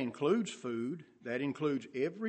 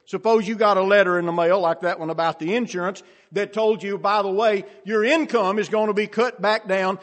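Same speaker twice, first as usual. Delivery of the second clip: loud, high-pitched sermon delivery; raised preacher's voice, punching the key words; full-force preaching; 9 semitones higher, 11 dB louder than usual. Real, not acted. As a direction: hammer each figure home to the congregation.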